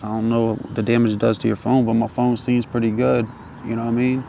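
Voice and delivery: usual speaking voice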